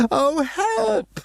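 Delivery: very high terrified call; frightened cry. Acted. Harvey crying for help, very high and frightened. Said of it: "yelled Harvey".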